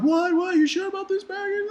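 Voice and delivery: very high, mock-alarmed voice; shocked disbelief. Djinns questioning Aladdin's bargain, imitated alarm. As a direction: squeak it out as imitated astonishment.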